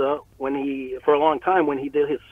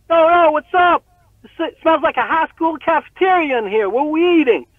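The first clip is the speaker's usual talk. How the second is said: pitched-up, booming rapper's greeting; 12 semitones higher, 4 dB louder than usual. Retold or imitated. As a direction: imitate a big rapper calling out on arrival. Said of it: "that rugged voice".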